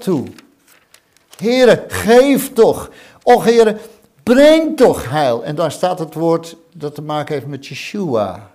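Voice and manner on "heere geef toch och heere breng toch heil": high, drawn-out cry to God; drawn-out shouted prayer for salvation; loud slow plea. Acted. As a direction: stretch every word, begging loudly. Crying out to God.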